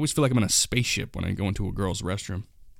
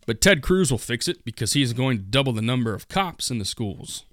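normal talking voice

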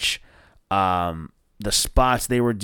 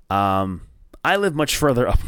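ordinary talk

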